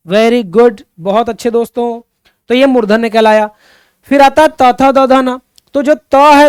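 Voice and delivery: usual speaking voice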